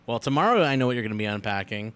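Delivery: usual speaking voice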